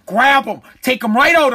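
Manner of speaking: normal talking voice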